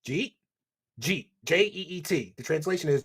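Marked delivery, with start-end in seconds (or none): 0.1-1.3 jeet as one quick syllable, "jeet?" rising like a question; Philly jeet. Shown as a demonstration. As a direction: squash "did you eat" into jeet. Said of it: "another big Philly thing is too is jeet".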